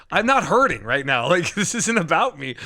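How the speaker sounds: protesting. Retold or imitated. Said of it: "I'm like".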